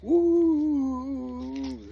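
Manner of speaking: very high, very loud whistle woo; imitated train whistle. Narrator imitating train whistle answering, very high loud woo. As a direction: blast it like a train horn. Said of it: "all of a sudden".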